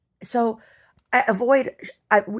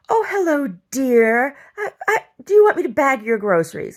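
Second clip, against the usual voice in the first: high, loud, syrupy mock-sweet cashier voice; 7 semitones higher, 7 dB louder than usual. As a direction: ham up the cashier's sugary "dear". Mocking the cashier who called her dear.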